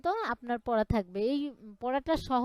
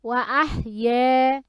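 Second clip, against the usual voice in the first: recitation for repeating; 6 dB louder than usual. Shown as a demonstration. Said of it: "বলেন".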